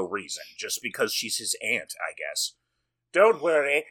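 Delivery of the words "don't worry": higher, louder put-on voice; lightly voiced boy lord reassuring; a first, milder stab at the character; reassuring young Victor. First take of acted Victor line.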